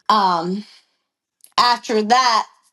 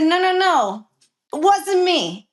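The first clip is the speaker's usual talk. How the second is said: higher-pitched insistent protest; retold denial; 6 semitones higher than usual. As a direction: deny it firmly, replaying the quarrel. Acted denial in retold quarrel.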